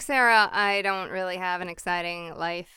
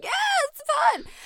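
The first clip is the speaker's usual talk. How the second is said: put-on drunk cheer. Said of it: "it's like".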